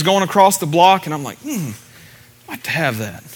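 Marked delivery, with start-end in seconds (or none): 1.4-3.2 soft, low murmur of wanting; quiet musing. Retold or imitated. Preacher recalling watching a car auction, quiet musing. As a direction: think aloud, softly and low.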